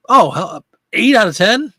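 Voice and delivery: loud, high surprised outburst. Real, not acted. Reacting to a viewer's offer rule, loud surprised exclamation. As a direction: blurt it out as if that's obviously fine.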